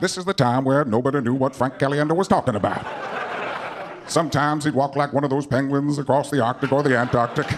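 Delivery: low, measured documentary narrator; Morgan Freeman. Acted. Narrator stepping in to explain the comic's slip, low and stately. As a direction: narrate the mishap with unhurried gravity. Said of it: "you'll need Morgan Freeman to help you out".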